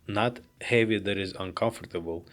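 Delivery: usual speaking voice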